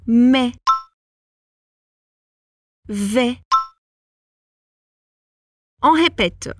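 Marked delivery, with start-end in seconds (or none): 0.1-0.5 Mais said with an open è, like mè; Mais, vowel held open and clear; open e sound. Shown as a demonstration. Teacher models the word for Brazilian learners, open e. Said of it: "o mais e o vais tem os dois esse som mais aberto". 2.9-3.4 Vais said with an open è, like vè; Vais, open e vowel, no final s; open e sound. Shown as a demonstration. Teacher models the word for learners, open e. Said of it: "o mais e o vais tem os dois esse som mais aberto".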